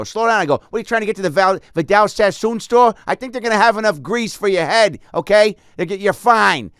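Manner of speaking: high, loud hammed-up cop; hammed-up forties cop scolding Stamos; mustached cop voice. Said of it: "I go with my whistle".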